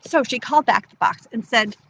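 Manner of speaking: ordinary delivery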